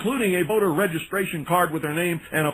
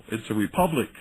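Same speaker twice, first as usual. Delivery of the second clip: ordinary delivery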